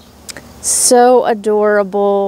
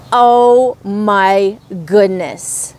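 ordinary delivery

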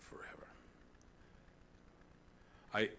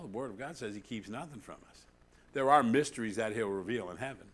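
normal talking voice

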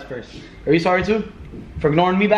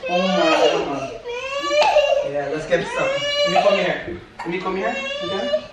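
put-on high baby-talk voice; 4 dB quieter than usual.

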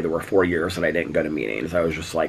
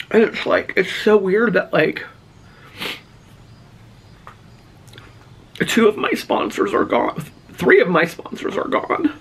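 high, choked, tearful voice; real emotional; 11 semitones higher than usual. Real, not acted. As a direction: grieve aloud, fighting back tears. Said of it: "I'm getting so emotional".

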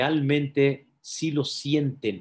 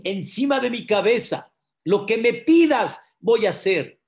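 raised, emphatic acted pledge; fervent promise; 8 semitones higher, 6 dB louder than usual. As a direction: swear eagerly to obey. Climber eagerly promises obedience. (promesa ferviente)

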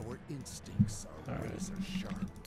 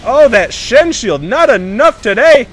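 loud, high, slow triumphant call; hyped taunt at the enemy team; hype gamer taunt; 7 semitones higher, 9 dB louder, slower than usual. Real, not acted. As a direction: crow it out like a winner. Gamer celebrating a dodge.

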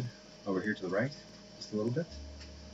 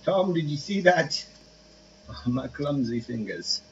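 higher, slow put-on British accent; hammed-up British shopkeeper accent; British-ish twang; 5 semitones higher, 5 dB louder than usual. Gamer voicing a clumsy shop worker to Tom, in a British accent. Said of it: "had a little bit of a twang".

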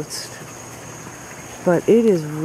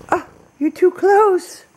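cooing at the cat; 13 semitones higher, 3 dB louder than usual. Real, not acted. Cat rubbing on camera.

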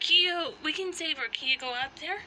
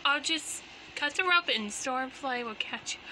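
usual speaking voice

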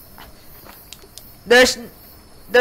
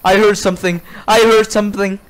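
ordinary delivery

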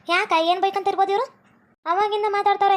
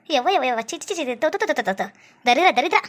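low, scandalised spluttering; disgusted; 6 semitones lower than usual. Acted. Villager shocked by modern clothes, low disgusted muttering. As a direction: spit out repeated little noises of disgust.

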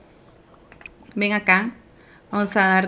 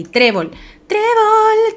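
high, drawn-out call; 12 semitones higher, 4 dB louder, slower than usual. Real, not acted. Player calling a game animal, high calling-out. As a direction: sing the name out slowly to summon.

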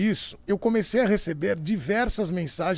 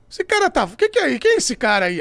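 higher and louder, a fed-up complaint; mock irritated operator; 4 semitones higher, 7 dB louder than usual. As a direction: imitate an annoyed sound tech losing patience.

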